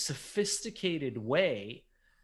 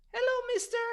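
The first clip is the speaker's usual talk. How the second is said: put-on high, sweet little girl; faster than usual. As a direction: pitch way up like a small child. Little girl voiced in story.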